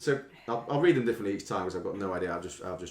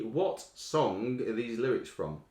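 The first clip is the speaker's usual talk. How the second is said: ordinary delivery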